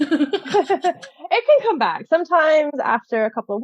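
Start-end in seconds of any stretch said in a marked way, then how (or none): 0.0-2.1 laughing through the words; amused, giggling, drawn out slowly. Real, not acted. Guest laughing at her accent coming back, laughing.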